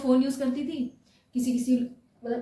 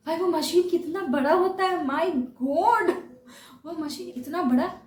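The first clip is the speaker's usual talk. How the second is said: awed; 6 semitones higher, 4 dB louder, slower than usual. Real, not acted. Awed by huge CT machine.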